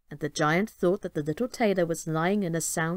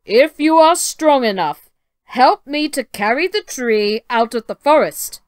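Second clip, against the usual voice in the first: booming giant; 4 semitones higher, 10 dB louder than usual. Acted. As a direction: boom it out like a proud giant. Giant dares the tailor.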